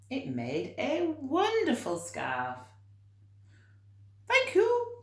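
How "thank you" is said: much higher, delighted thanks; grateful giraffe. Acted. Giraffe thanking George for the tie scarf, delighted. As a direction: squeal a happy thank you.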